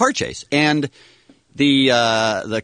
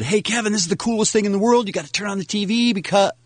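higher, quick, eager phone call; imitated excited caller; 7 semitones higher, faster than usual. Mocking the call his coworker never made, excited. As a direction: rattle it off like thrilling news, pitched up. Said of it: "I got a phone call from Mike".

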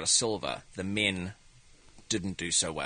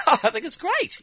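high, bright mock cheer; gloating; 4 dB louder than usual. Comedian gloating on the sidelines, high mock cheer.

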